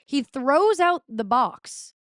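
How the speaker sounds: ordinary talk, nothing marked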